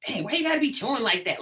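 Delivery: high, irritated snap at a parent; exasperated teen complaining; annoyed teen. Retold or imitated. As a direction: whine it like a fed-up teenager.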